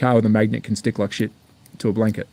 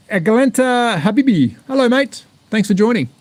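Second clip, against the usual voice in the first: bright greeting; 8 semitones higher than usual. Real, not acted. Playful greeting to stream viewers.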